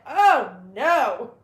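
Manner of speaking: big, loud "oh no"; loud retold dismay. Retelling being stunk out by a friend's dog, loud dismay. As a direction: re-enact the dismay at full volume. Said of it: "I was like".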